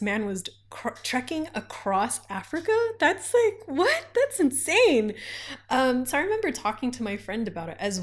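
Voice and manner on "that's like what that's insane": high, indignant what?; indignant high disbelief; outraged disbelief. Real, not acted. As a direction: pitch up in outrage. Outraged at the host.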